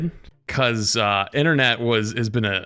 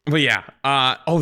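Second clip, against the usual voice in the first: ordinary talk, nothing marked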